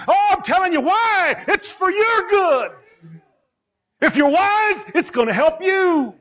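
high, shouted, voice catching on "It's for your good"; impassioned preaching. Acted. Preacher pleading with the church that God's words are for their good, voice breaking. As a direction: cry it out to them, almost breaking.

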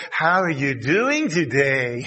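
slow, low patronizing question; mock slow talking-down voice; talking down to the old. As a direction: speak to an elder as if he can't follow. People talking down to an old person.

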